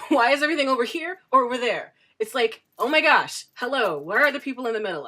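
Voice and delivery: incredulous loud rush of words; exasperated outburst. Real, not acted. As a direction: throw up your hands at the absurdity. Exasperated rant about polarized society.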